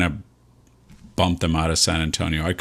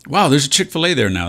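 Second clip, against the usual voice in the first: surprised; 7 semitones higher, 4 dB louder than usual. Real, not acted. Suddenly spots new restaurant.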